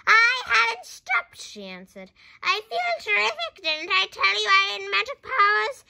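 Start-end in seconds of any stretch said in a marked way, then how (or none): 0.1-1.4 high, slow, loud grandma shout; shouting back. A narrator's reading. Grandma answers George from the roof, shouting. 2.4-5.8 loud, high, triumphant boast; boastful shouting. A narrator's reading. Grandma boasts of magic powers to George, shouting.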